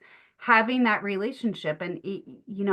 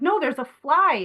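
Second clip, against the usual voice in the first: put-on insistent kids; 6 semitones higher, 5 dB louder than usual.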